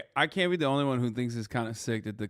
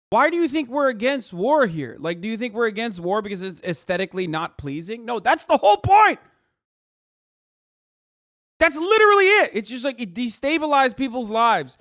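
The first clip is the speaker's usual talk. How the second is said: much higher, louder, yelling the point; heated rant; 8 semitones higher, 7 dB louder than usual. Real, not acted. Streamer yelling at chat about why war is wrong. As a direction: shout it at them, fed up.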